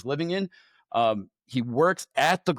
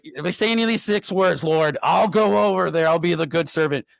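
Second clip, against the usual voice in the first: raised, louder promise to the Lord; eager vow; 4 semitones higher, 6 dB louder than usual. Retold or imitated. Bargaining with God in the Jeep, eager and loud. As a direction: swear it out loud, all in.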